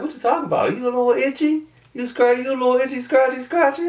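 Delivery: high-pitched retold teasing, attempted New Orleans accent; mock New Orleans banter. Retelling banter over itchy seats, mock New Orleans accent. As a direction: keep the silly high New Orleans imitation going. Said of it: "I cannot do a New Orleans accent".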